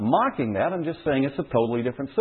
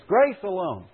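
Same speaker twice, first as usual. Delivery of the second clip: normal talking voice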